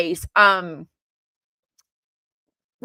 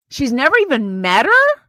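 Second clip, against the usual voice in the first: loud, high incredulous question; gasp, then blurt it out in disbelief, rising at the end; shocked disbelief; 4 semitones higher, 8 dB louder than usual. Real, not acted. Vlogger reacting to news about the attorney, shocked.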